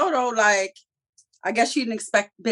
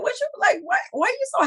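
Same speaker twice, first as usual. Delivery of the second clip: put-on way higher, puzzled girlish question; high mock Liliana voice; faster than usual. Liliana asking Tommy why he's so happy, high mock voice. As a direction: squeak the question up high like her.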